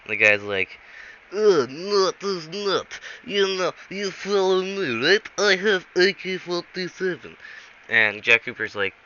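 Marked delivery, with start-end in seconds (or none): 1.3-7.4 put-on high-pitched, garbled Russian accent; badly faked Russian, drawn out and pitched high; fake Russian accent. Fake agent insisting after being mocked, horrible Russian accent. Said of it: "fake Russian accent".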